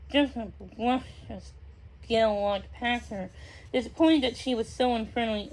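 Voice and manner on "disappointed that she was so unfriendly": ordinary delivery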